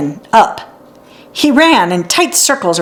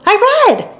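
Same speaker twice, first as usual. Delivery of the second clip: much higher, proud "I read. I read two words."; girl proud of first reading; proud and delighted; 12 semitones higher than usual. A narrator's reading. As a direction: burst out with joy at reading. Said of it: "She laughed".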